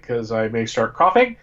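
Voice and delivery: normal talking voice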